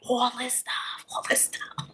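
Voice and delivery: hushed gossipy whisper; breathy conspiratorial whisper, trailing away. Acted. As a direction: lean in and whisper it like a secret. Gossip about what they put in.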